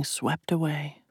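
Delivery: soft slow whisper. A narrator's reading. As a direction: drop to a hushed whisper. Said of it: "unvoiced whisper".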